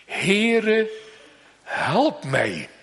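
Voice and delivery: a light plea, "Heere" lifted, "help mij" falling; pleading mother. Acted. Preacher voicing the desperate mother, mildly pleading. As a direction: voice the woman's cry for help.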